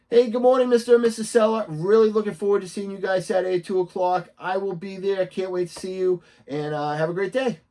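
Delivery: high, loud hello, settling slightly as it goes; upbeat morning greeting. Shown as a demonstration. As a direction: greet the clients with big recorded-message energy. Demonstrates the morning video text.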